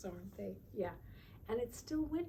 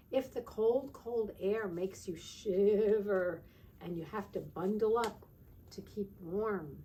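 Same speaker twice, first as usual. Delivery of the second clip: louder, slow storybook read; reading to children; 9 dB louder, slower than usual. Acted. Teacher reading the winter book to children, louder and slower. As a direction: make the cold sound shivery and big.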